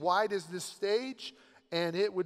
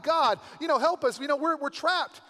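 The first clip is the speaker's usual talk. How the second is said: desperate plea, a touch louder; crying out; 7 semitones higher, 5 dB louder than usual. Retold or imitated. Moses crying out to God for help, a raised desperate plea. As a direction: call out to God for rescue. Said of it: "Moses begins to cry out to God".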